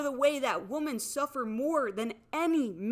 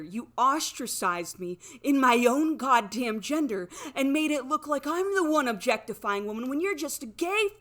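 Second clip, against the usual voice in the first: climactic furious scream; 6 dB louder than usual. Real, not acted. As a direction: explode at him on the last words. Final furious outburst.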